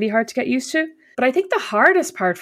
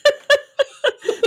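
high burst of laughter; real, delighted cackle. Real, not acted. Friend hears the earnest impression, high laugh.

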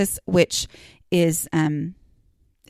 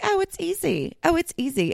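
mock breezy; 9 semitones higher than usual. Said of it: "somebody's like".

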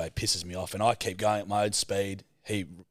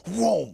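high, loud mimicked engine vroom; imitated car noise; 9 semitones higher, 4 dB louder than usual. Imitates car roaring onto gravel.

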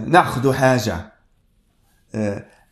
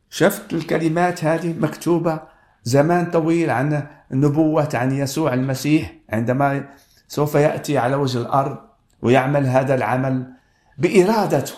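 ordinary talk

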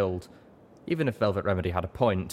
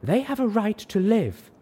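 much higher, louder, slow; insistent shout; 6 dB louder, slower than usual. A narrator's reading. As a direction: insist on it, drawing it out. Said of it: "she shouted back".

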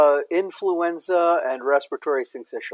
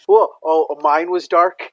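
surprised; 5 semitones higher, 7 dB louder, slower than usual. Real, not acted. Surprised reaction to poison.

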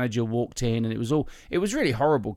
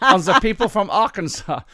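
higher, louder mock German complainer; offended German guest; 8 semitones higher, 7 dB louder than usual. German visitor scolding the comedian, mock-offended and loud. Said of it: "this German guy showed up and said".

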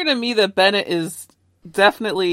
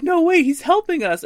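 higher, louder urgent protest; urgent Matt; 11 semitones higher, 5 dB louder than usual. Retold or imitated. Matt stopping Peter unplugging, higher urgent protest. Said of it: "and Matt's like".

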